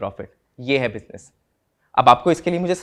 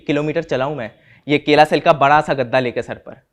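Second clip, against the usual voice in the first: ordinary delivery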